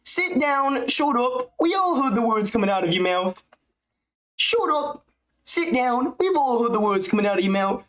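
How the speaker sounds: high-pitched mocking put-on voice; Ian Gary impression. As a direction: pitch way up, whiny and scolding. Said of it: "Gary impression".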